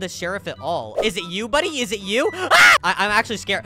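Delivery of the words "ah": very high sudden shriek; startled scream. Real, not acted. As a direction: scream in fright. Scared by player.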